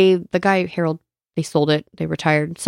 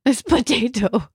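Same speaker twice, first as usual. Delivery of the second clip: higher, slow "It's potato" in a put-on Russian accent; mock Russian accent; 4 semitones higher, slower than usual. Friend repeats the joke in a mock Russian accent. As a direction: echo the line in a hammed-up Russian accent. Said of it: "echoing mock Russian line".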